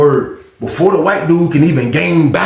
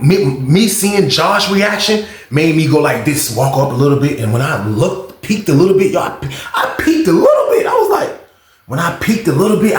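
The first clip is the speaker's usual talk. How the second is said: ordinary talk, nothing marked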